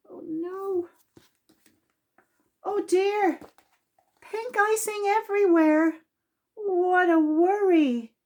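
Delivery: high, fretful little voice for a worrier; fretting Mister Worry. Acted. Mister Worry sees pink icing everywhere, high and fretful. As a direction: wring your hands as a flustered little man.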